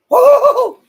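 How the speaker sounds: loud, high-pitched whoop of joy; long, high celebratory "woohoo"; excited woohoo. Real, not acted. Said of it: "I get excited when I see that".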